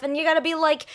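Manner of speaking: ordinary delivery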